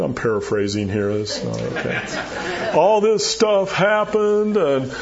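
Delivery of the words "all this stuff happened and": higher, slow self-pitying whine; whiny Elijah. Retold or imitated. Elijah whining to God in the cave, slow higher mock complaint. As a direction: complain like a sulking prophet.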